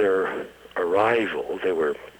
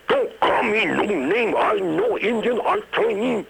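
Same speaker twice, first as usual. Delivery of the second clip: much higher, louder fake Chinese inflection, testy; testy mock Chinese accent; 4 dB louder than usual. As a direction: Konos snaps back at the bouncer in fake Chinese accent. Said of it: "replied Konos testily in his finest Chinese inflection".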